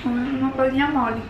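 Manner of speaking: chewing candy while talking; speaking while chewing. Real, not acted. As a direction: mouth partly full. Seen 0: Woman's jaw works and teeth clench while talking about candy.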